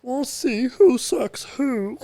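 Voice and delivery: very high, drawn-out, loud Kermit voice; slow mock-tearful squeal; hammed-up Kermit parody, stretched out; Kermit-like Jordan Peterson. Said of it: "that was heavy on the Kermit".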